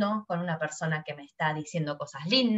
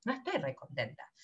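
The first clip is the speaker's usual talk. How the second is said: much softer and lower, "No estoy re contenta" sounding dejected; glum; 6 semitones lower, 11 dB quieter than usual. Shown as a demonstration. Teacher showing a glum tone to the class. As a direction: mutter it, clearly not happy. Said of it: "mi voz, mi tono te está diciendo".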